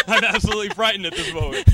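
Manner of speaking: laughing through the words; giggling while joking about fear. Real, not acted. Laughing after an awkward answer.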